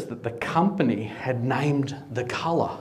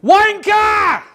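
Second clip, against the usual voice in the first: high, full-force yelled insult; furious scream; 10 dB louder than usual. Retold or imitated. Angry driver screaming at the truckie beside him, furious scream. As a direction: scream it out in road rage. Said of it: "and he screamed".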